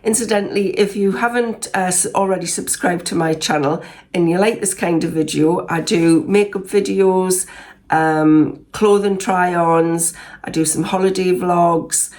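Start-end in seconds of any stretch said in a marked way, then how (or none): none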